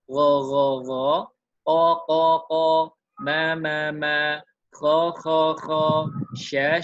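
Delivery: each letter called out loudly three times, same beat; loud drill recitation. Shown as a demonstration. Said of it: "Sama bacanya". (pelafalan keras berulang satu ketuk)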